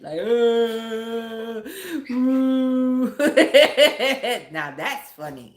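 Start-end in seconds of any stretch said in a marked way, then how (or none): 0.2-3.1 loud, high mock wail; mock crying. Mother imitating her kids crying, mock wailing. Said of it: "Now they crying and shit". 3.2-4.5 loud, high burst of laughter; laughing. Real, not acted. Mother amused by her crying imitation, loud laughing.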